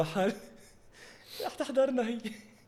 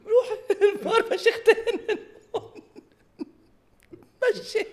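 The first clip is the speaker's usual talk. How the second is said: high laughing mock command; mock bossy. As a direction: bark it, half laughing. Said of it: "قلت لها".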